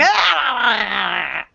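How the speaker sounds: drawn-out imitated otter squall; mock animal screech; otter cry. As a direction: wail like a fighting otter. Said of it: "the otter is going".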